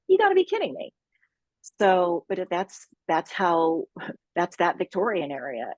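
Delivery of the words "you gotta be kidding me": high-pitched retold disbelief; incredulous, voice shooting way up; mock incredulous. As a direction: squeak it out in put-on exasperated disbelief. Retold reaction to unfair inheritance.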